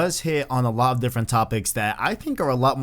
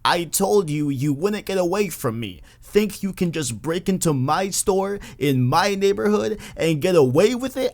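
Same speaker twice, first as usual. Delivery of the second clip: put-on high scolding old lady; grandma voice; 6 semitones higher than usual. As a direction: shout it like a furious grandma shopkeeper. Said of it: "the best grandma voice".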